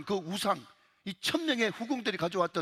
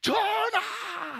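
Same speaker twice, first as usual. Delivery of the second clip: drawn-out high cry of 전하 like a minister in a TV period drama; pleading court official; 5 dB louder, much slower than usual. Retold or imitated. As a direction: wail 전하 long and high, begging the king. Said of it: "그때 막 고관 대작들이". (사극 대신처럼 길게 높이 외치는 전하)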